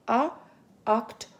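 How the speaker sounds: ordinary talk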